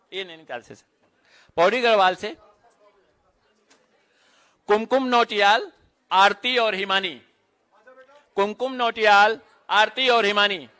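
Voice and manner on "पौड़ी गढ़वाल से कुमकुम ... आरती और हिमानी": measured announcer voice, each name set apart; roll-call announcer. Real, not acted. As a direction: call out names at a ceremony.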